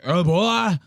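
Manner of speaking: a hammed-up caught servant; mock frightened oh boy; mock slave voice. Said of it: "the face he makes when they catch him".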